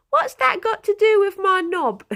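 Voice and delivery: high, loud little Cockney voice; Cockney kid. Retold or imitated. Little kid butting into a big argument, high Cockney voice. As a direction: pipe up like an indignant Cockney kid. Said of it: "little Cockney voices".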